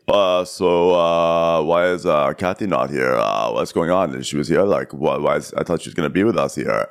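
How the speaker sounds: low, halting dopey-husband impression; put-on low voice full of uhs; Mauricio. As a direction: drop the pitch and stumble through the question. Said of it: "So then Mauricio's like".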